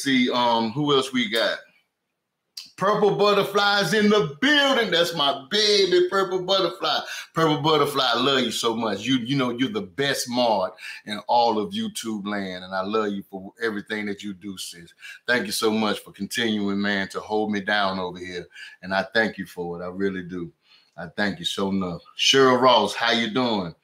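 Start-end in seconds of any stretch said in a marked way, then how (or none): 2.8-9.0 delighted shout-out. Real, not acted. Hailing favorite moderator. 9.7-22.0 sincere thanks. Real, not acted.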